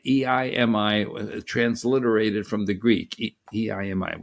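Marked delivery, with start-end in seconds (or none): none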